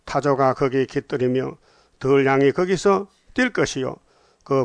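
firm, declaimed Bible recitation; scripture reading. Acted. Preacher reading the prophecy of a desolate Babylon, firm declamation. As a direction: proclaim the verses phrase by phrase, drawing out endings.

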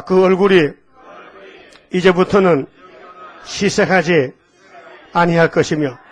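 preacher reciting scripture aloud; leading a recitation. Shown as a demonstration. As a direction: call out the verse for all to echo. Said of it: "따라합시다".

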